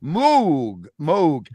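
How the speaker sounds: Moog said slowly, its vowel half O, half U; demonstrated pronunciation. Shown as a demonstration. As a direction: round the vowel of Moog between "mohg" and "moog". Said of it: "So it's half O, half U".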